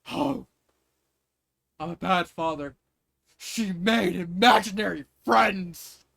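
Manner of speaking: loud, slow overwrought lament; hammed-up father despairing over his daughter; mock dramatic father. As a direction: ham up the guilty dad. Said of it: "just going immediately straight to".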